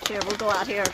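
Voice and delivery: ordinary talk, nothing marked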